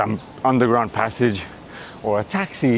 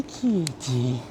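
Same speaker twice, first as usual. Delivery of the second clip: cat-calling voice; 8 dB quieter than usual. Real, not acted. Coaxing a stray cat.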